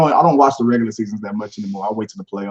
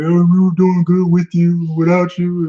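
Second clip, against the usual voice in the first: higher, slow, drawn-out touchy whine; mock sensitive; 6 semitones higher, 3 dB louder, slower than usual. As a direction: imitate a touchy player, dragging it out. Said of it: "he was being real sensitive".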